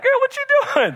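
high, loud, laughing disbelief; incredulous yell. Retold or imitated. Father at the game yelling at his daughter, high and incredulous. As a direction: shout it up high at your daughter. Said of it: "I'm like".

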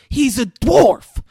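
high, loud, drawn-out yell; acting out a furious scream at a partner; retold screaming. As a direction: blurt it out at full volume. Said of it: "screaming this at her".

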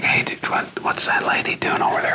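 whispered aside to a buddy; mock whisper. Somebody chattering to a friend at an event, whispered. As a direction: imitate someone whispering through somebody's speech. Audience member chatting during a talk.

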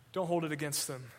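hushed, gentle release; soft forgiveness. Acted. Quoted dying forgiveness.

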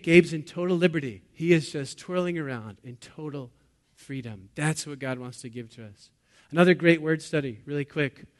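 ordinary talk, nothing marked

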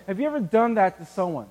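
ordinary talk, nothing marked

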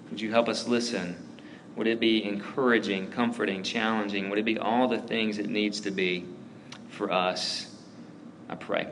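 soft, unhurried prayer to God; closing prayer. Acted. Pastor praying quietly for the congregation. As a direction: pray it gently over the room.

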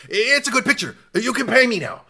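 high-pitched oddball pitch; the Hitchhiker. Acted. Hitchhiker selling his photo to the van, high character voice. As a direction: imitate an odd peddler, voice jumped up high. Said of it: "exchanges that he has with them".

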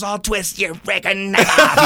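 slow, strained mock threat; imitating angry threat. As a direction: act out his friend's threat, dragging the words. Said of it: "he's just going like that".

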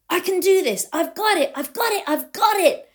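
high, loud burst of confidence; triumphant, soaring pitch; excited self-talk. Retold or imitated. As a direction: punch out each phrase, thrilled and high. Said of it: "I'd be really excited".

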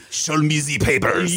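slow, high mock-German demand; mock German officer; German accent. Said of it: "I was expecting, like".

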